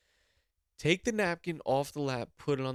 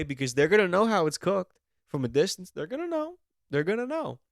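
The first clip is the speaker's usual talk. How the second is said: emphatic insistence; 6 semitones higher, 5 dB louder than usual. Acted. Insisting loudly.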